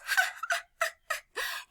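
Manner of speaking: high, staccato pretend laugh; tiny birds' cackle; thin, jeering ha-ha-ha; mocking bird laughter. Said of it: "they laugh at Toad".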